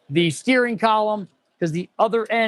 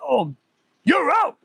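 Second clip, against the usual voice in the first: mock dodgeball yell; 8 semitones higher, slower than usual. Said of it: "He's like".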